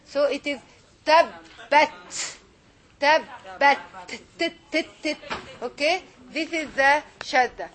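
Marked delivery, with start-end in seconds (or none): 1.0-3.8 "tabbat" split firmly as tab-bat; slow, emphatic "tabbat", doubled B held; strengthened double letter. Shown as a demonstration. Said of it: "I will strengthen the letter". 4.1-5.2 short separate "t" bursts; each "t" said alone, with a pause between; isolated letter sound. Shown as a demonstration. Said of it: "as if it is two t".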